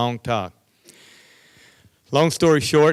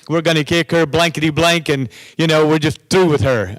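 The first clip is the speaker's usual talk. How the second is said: raised, loud demanding voice, higher; demanding students; 6 semitones higher, 5 dB louder than usual. Retold or imitated. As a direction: act out angry kids storming in.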